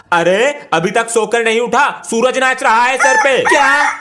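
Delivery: mocking laughter. Classmates mocking a late riser.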